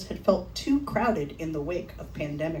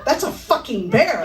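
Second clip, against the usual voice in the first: higher, loud exclamation; alarmed realisation; 5 semitones higher, 5 dB louder than usual. Acted. Hiker realising what the animal is, alarmed outburst. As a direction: blurt it out as the truth hits. Acted alarm at seeing bear.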